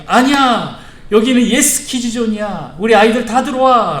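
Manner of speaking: high, loud calling out; emphatic welcome. Acted. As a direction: overrule them and summon all the children in. Jesus overrules disciples, welcomes children. (높고 크게 아이들을 불러들이듯)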